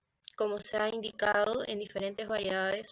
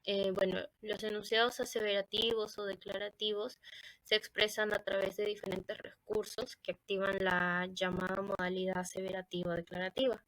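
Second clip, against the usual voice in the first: ordinary talk, nothing marked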